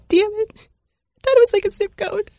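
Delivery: high voice squeezed by laughter; helpless laughing. Real, not acted. Host caught misreading username.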